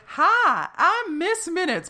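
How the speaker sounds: high, bright cartoon clock voice; chirpy animated mascot greeting; cheery cartoon voice. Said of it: "this is Tara Strong doing the voice".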